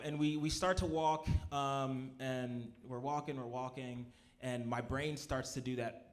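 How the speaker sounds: usual speaking voice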